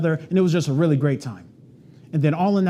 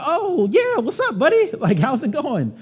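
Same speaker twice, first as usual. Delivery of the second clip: much higher, hammed-up buddy voice; fake friendly; 11 semitones higher, slower than usual. Agent fakes knowing the stranger, hammed-up buddy greeting. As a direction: pretend you know him, overly chummy.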